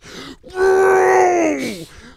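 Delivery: loud high burst of laughter. Real, not acted. Laughing hard at his dad's helicopter story, loud burst of laughter. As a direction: let the laugh explode.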